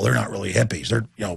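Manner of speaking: ordinary talk, nothing marked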